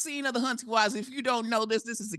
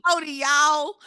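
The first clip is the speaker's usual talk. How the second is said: 1 mock southern howdy; 6 semitones higher, 6 dB louder, slower than usual. Mock cowgirl greeting.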